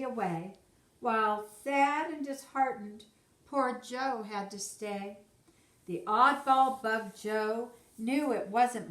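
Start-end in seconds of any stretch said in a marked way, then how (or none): none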